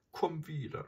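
mild tearful plea, pitched high; a touch of a sob, voice lifted high; sobbing. A narrator's reading. Mother begs Mowgli to return, lightly sobbing. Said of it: "schluchzte Messua".

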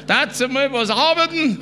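high-pitched mock scolding; retold yell. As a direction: imitate an indignant holler. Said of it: "schreit er um und sagt".

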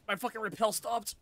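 ordinary talk

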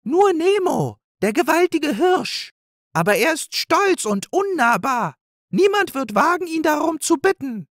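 very high, squeaky squirrel; a tiny high squirrel voice, sure of itself. A narrator's reading. The squirrel tells the animals, very high and squeaky.

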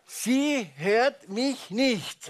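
very slow, word by word; slow, spaced-out realisation. Acted. Doctor grasping that the wife is deaf, slow and deliberate. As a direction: spell it out one slow word at a time.

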